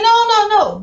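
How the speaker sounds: high, sing-song no no no; playful refusal. Retold or imitated. Wife refusing to pay the electric bill with her winnings, playful. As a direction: tease him as you refuse.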